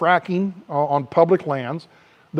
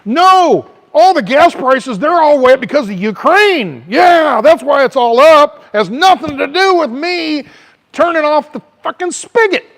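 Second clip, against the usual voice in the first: high, loud mocking Biden; whiny shouted denial, hammed up; 8 semitones higher, 7 dB louder than usual. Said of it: "Biden can say".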